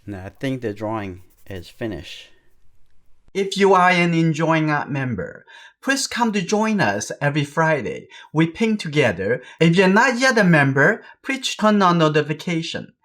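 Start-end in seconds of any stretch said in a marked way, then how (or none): 3.3-12.9 high, loud channel sign-off pitch; bright announcer invite to join; upbeat outro promo. Acted. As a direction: sell the membership like a cheerful ad. Channel outro promo over music.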